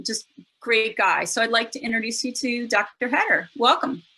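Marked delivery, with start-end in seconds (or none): none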